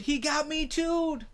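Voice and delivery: mock wounded put-on voice. Repeats bit in put-on voice.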